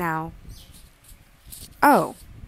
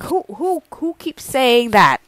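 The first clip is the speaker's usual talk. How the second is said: usual speaking voice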